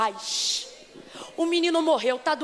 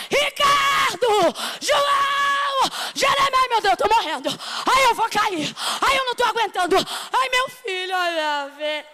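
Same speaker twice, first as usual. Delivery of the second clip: much higher and louder hysterical cries; desperate screaming; 6 semitones higher, 4 dB louder than usual. Pretend. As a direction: wail for help as if about to faint. Imagined mother screaming for help. (gritos histéricos, muito mais altos)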